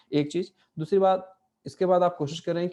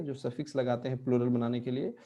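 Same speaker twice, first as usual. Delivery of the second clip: usual speaking voice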